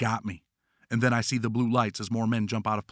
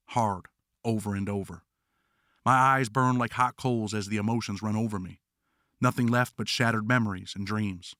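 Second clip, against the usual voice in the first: ordinary delivery, nothing marked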